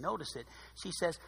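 ordinary delivery

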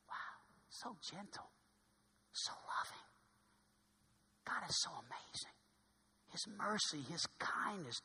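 soft, breathless whisper of wonder; awed whisper. Real, not acted. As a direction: hush it out in awe. Awed whisper about God.

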